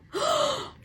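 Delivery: sharp high intake of breath; real astonished gasp. Real, not acted. Reader seeing the devil card, gasping. Startled by the cards.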